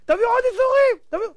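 high-pitched acted yell; frantic high shout, cut short. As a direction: cry out as if waking mid-dream. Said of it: "והוא צועק".

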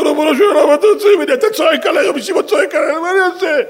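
high, loud hammed-up tearful complaint; wailing angel. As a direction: wail helplessly to God as a mock-crying angel. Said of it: "מלאך בוכה".

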